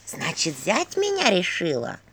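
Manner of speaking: slow husky skull voice; сиплый голос черепа. A narrator's reading. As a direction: rasp it slowly like an old skull. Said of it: "послышался сиплый голос черепа". (медленный сиплый голос черепа)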